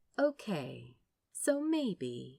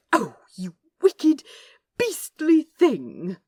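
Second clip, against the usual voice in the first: loud, high, slowing scold; mock scolding; 7 semitones higher, 12 dB louder, slower than usual. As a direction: scold him, half playful. Angel scolding.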